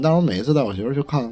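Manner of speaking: ordinary talk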